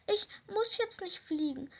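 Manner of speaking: high, near-tearful "muss" squeak; squeaky. Acted. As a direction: peep "ich muss jetzt nicht fliegen" high and whiny. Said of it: "piepste die kleine Raupe".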